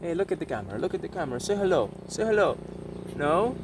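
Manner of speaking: high, coaxing pet talk; teaching a parrot. Real, not acted. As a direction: sweet-talk the bird into speaking.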